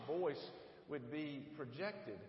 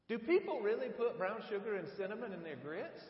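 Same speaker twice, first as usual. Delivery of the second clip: incredulous question; 8 semitones higher, 6 dB louder than usual. Retold or imitated.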